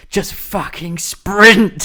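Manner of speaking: loud, high and drawn-out exasperation; frustrated yell. Retold or imitated. Player yelling at a slow game character, frustrated. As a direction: yell it slowly, venting at the game. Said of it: "I get so frustrated with it".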